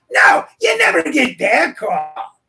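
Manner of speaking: high, slow exasperated shout; hammed-up aggrieved shout; exasperated outburst. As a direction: cry out in mock indignation, stretching the words. Repeats acted exasperated reply.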